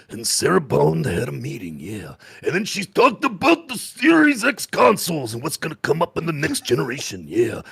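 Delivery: imitating a gruff, wrecked voice; lost voice impression. Mimicking his hoarse Magcast voice to co-host, gruff and strained. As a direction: croak it out like a hoarse wrestler. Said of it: "that's basically what I sounded like".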